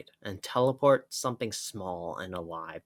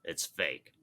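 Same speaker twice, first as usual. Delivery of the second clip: low dry Wolverine; 5 semitones lower, 5 dB quieter than usual. Acted. Wolverine sniffs the cactus.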